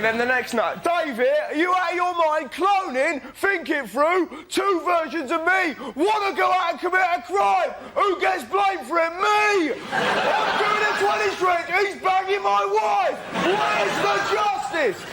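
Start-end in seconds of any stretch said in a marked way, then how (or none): 0.8-9.7 high-pitched, shouted outrage; outraged rant. Acted. Acting out outraged ranting radio caller. 9.9-15.0 high, slow shouted complaint; wailing outrage. Acted. Acted nutter wailing about injustice.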